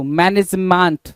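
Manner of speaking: slow MAN-ej-MAANT; misread management. Shown as a demonstration. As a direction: say मैनेजमेंट slowly with "maant" ending. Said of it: "क्या बोल रहा है भाई". (मैनेजमेंट को धीरे "मैनेजमांट" बोलें)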